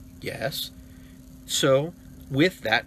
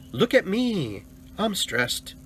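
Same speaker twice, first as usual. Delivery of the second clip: higher-pitched mock plea for attention; mock attention-seeking; 7 semitones higher, much slower than usual. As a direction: parody someone showing off their stress. Mocking attention-seeking stress story.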